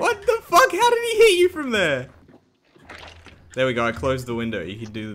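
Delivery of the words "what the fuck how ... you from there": very high, incredulous squeal; shrieking disbelief. Real, not acted. Player baffled by an impossible hit, high-pitched disbelief. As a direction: squeak it out in shock. Shot unexpectedly in the game.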